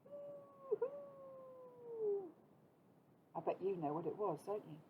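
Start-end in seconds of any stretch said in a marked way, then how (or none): 0.0-2.3 high, very soft drawn-out hoo; soft owl hoo. Shown as a demonstration. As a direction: breathe out a soft owl call. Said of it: "Can you make that sound?".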